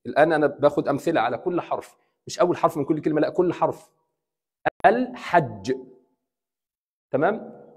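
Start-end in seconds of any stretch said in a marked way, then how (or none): 4.9-5.7 الحج as "al", then "hajj", L clear; lam pronounced. Shown as a demonstration. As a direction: hold the L in الحج before the h. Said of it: "بنطق اللام".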